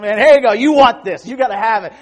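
high, loud salesman pitch; slick used car salesman. Acted. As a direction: close the deal hard. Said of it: "used car salesman".

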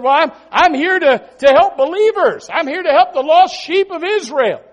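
high, loud preacher's declaration; emphatic proclamation. Retold or imitated. Preacher voices Jesus answering the crying mother, emphatic proclamation. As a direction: proclaim it forcefully over her cries.

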